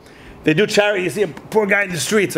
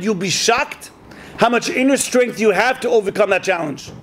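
ordinary delivery

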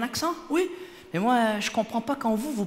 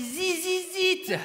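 put-on French-accented English; 8 semitones higher, much slower than usual. Lady repeating the tour's name, exaggerated French accent. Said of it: "je pense qu'elle parlait de This Is It".

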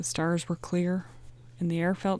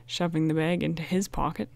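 usual speaking voice

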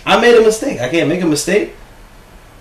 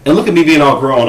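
normal talking voice

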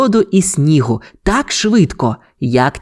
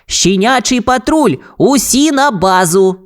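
higher-pitched rallying order, stretched at the end; commanding call; 8 semitones higher than usual. A narrator's reading. Ryder summons the pups to base, high rallying call. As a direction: summon the team, drawing out the last words. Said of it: "наказав".

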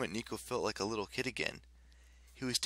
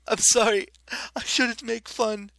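much higher, loud, laughing through it; 13 semitones higher, 12 dB louder than usual. A narrator's reading. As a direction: apologize while still laughing. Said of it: "Raina laughed".

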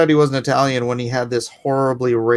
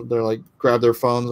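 normal talking voice

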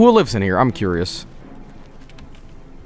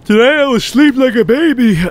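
put-on baby voice; 13 semitones higher, 7 dB louder than usual. Baby voice after baby Jotles.

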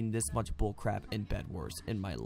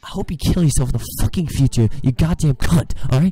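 full-throated furious scream, much louder; screaming rage; 4 semitones higher, 17 dB louder than usual. Real, not acted. Raging gamer screaming at the teamers, furious scream. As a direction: scream it out in pure fury.

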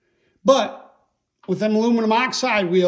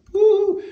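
high-pitched "woo"; delighted whoop; 12 semitones higher, 4 dB louder than usual. Real, not acted. Showing viewers how it sparkles, delighted high whoop. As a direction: let out a quick, high woo of admiration. Admiring the sparkly wheel material.

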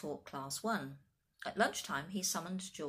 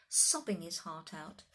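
usual speaking voice

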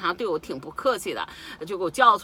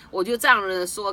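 ordinary talk

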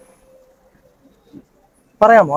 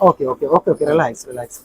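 low, soft calming voice; soothing hypnotist; 7 semitones lower, 6 dB quieter than usual. Real, not acted. Hypnotist calming a crying woman, soothing. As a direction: settle them down with a hushed, low repetition.

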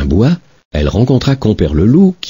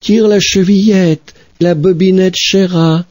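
wolf faking a gentle grandmother, higher; softened wolf voice; 13 semitones higher, slower than usual. Acted. Wolf in bed luring the girl, gently put-on. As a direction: sweeten the voice to lure her. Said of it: "en adoucissant un peu sa voix".

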